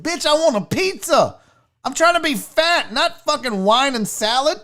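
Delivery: high, indignant outburst; worked-up, exasperated protest; angry rant. Real, not acted. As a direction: rant at the fancy restaurant. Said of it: "why I got so angry with that".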